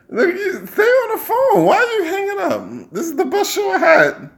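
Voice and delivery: squeaky, half-laughing objection; high-pitched protest. Real, not acted. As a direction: voice shooting way up in complaint. Protests at a caller hanging up.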